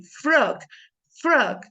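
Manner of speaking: frog said slowly and clearly as a model word. Shown as a demonstration. Teacher modeling frog for students to repeat. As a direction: say frog carefully for the class to copy. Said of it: "listen, listen carefully".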